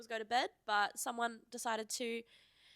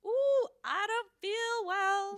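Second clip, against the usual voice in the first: high, loud, drawn-out moan; re-enacted sick wail; 10 semitones higher, 8 dB louder, much slower than usual. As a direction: wail it slowly, hamming up the sickness. Re-enacts waking up sick at night.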